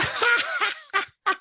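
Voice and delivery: high teasing cackle; mocking laugh. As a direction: laugh in his face. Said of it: "So I laughed at him".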